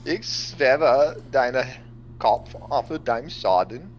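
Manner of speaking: mock German. Player speaks mock German in character.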